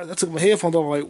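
normal talking voice

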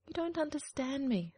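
hurt duckling. Acted. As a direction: plead like a sad duckling. Duckling pleads.

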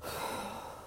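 long soft breath out through the mouth; deep calming breath. Real, not acted. Steadying herself while telling listeners about anxiety, a deep breath out. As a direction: breathe out slowly to calm down. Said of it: "take a deep breath".

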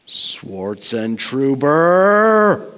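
slow, drawn-out rumble on each syllable of the name; rumbling teacher call-out. Retold or imitated. Calculus teacher calling out a chatty student, slow rumbling summons. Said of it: "would just in this rumbling voice".

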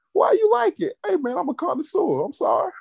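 high squeaky mock excuse; mock sheepish fan. Mocking sheepish porn fan.

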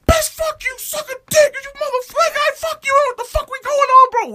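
loud, very high-pitched tirade; retold road-rage screaming, all out; angry yelling. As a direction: hurl abuse at a stranger from the car. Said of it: "yelling at this".

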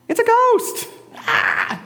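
high, loud frightened cry; acted terrified shout ending in a yelp; crying out in fear. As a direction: shriek it like you have seen a ghost. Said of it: "they cried out in fear".

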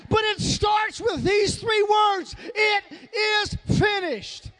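loud, stretched-out sermon climax; ringing pulpit declaration; full-force preaching. Real, not acted. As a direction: proclaim it to the whole church, slow and emphatic.

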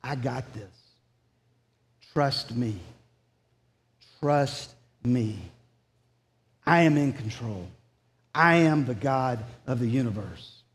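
gentle whispered-close promise, mildly voiced; God reassuring. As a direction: hush it down like God comforting a believer. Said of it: "He says to you as a believer".

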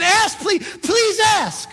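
loud, high pleading shout; God begging. Acted. Preacher voicing God pleading with listeners. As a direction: beg them to ask, full voice.

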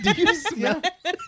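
The Rock catchphrase. Retold or imitated. Friends goofing on The Rock, slow laughing taunt. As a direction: drag it out while cracking up. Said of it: "do The Rock".

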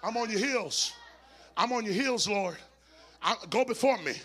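climactic whooped sermon over the organ; full-throated gospel whoop; preaching at full force. Acted. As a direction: preach it out, shouting and near singing.

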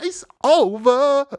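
laughing it out, slow and high. Real, not acted. Vlogger gloating over City, laughing. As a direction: crack up while saying it.

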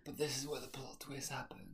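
hushed, whispered reveal; conspiratorial whisper. Pretend. Pitching the plot twist to co-host, in a whisper. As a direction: whisper it like a secret.

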